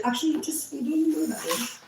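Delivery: high-pitched dismissive imitation; mock brush-off. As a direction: wave them off in a high voice. Acting out his brush-off.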